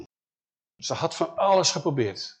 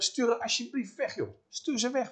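higher, softer pleading aside; geïrriteerd verzoek; 7 semitones higher, 5 dB quieter than usual. Acted. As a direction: nag him in a hushed, high voice. Disciples beg him to dismiss her. (hoog, zacht aandringend)

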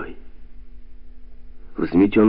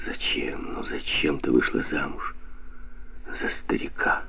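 softer, whispered reproach; 4 semitones lower, 9 dB quieter than usual. A narrator's reading. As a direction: breathe the reproach without voice. Ghostly voice answers inside her.

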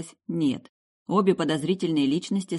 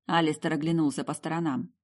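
plain reading voice

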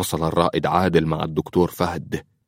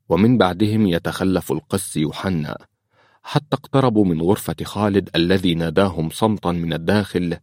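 plain reading voice

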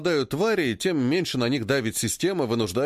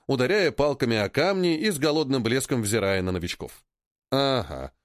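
ordinary delivery

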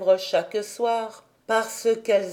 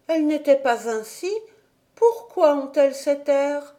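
put-on upset child; 6 semitones higher than usual. A narrator's reading.

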